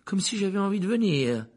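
put-on boy's high, cool retort; cold retort. A narrator's reading. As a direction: answer back with mild coldness. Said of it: "répliqua froidement Harry".

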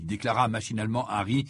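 plain reading voice

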